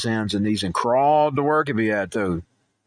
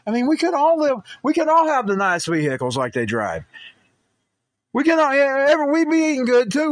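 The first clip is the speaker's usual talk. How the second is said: excited; 12 semitones higher, 4 dB louder than usual. Real, not acted. Excited about what the money would buy.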